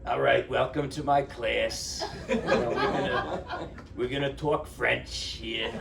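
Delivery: laughing imitation of a thick Queens accent; mock a Brooklyn teacher's thick Queens accent, chuckling. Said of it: "spoke with the thickest Queens or Brooklyn accent".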